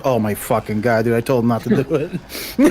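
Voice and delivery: low, frank backstage grumble; mock private rant. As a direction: drop low like a private complaint. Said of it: "behind closed doors".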